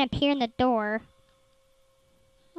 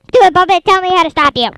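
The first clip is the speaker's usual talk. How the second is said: exasperated shout; 5 semitones higher, 11 dB louder, faster than usual. Real, not acted. Pleading with game enemy.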